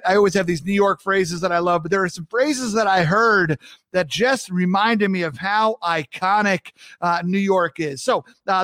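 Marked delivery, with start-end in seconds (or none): none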